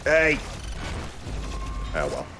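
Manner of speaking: ordinary talk, nothing marked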